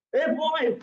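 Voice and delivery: high, loud call of the PT master; teacher's call. Retold or imitated. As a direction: call out sharply to a pupil. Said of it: "He say".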